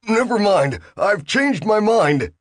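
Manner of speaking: high, slow, nervous protest; Bill's high alarmed voice. Acted. Bill backing out to the machine, high and nervous. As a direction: pitch it up and draw it out, rattled.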